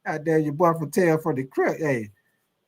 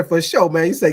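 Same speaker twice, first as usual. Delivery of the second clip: normal talking voice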